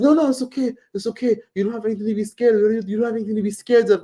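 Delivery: high-pitched imitation of a persistent man; insistent reassuring stranger. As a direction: mimic a stranger talking away someone's fear. Voicing stranger insisting it's okay.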